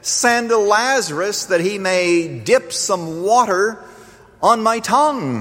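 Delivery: high, slow plea from torment; anguished crying out. Acted. Rich man in Hades begging Abraham for water, anguished crying out. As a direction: cry up in torment, drawing the words out. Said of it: "he cried up and said".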